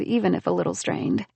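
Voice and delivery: usual speaking voice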